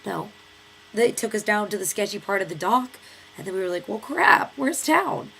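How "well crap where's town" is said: high, loud frustrated cry; lost and fed up; dismayed. Retold or imitated. Lost at the sketchy dock, high loud frustrated cry.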